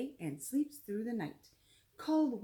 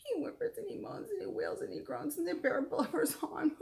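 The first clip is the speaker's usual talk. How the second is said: much higher, hammed-up blubbering read; mock whimpering; 8 semitones higher, faster than usual. A narrator's reading. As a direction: pretend to wail like a sad bear. Bear cries in story.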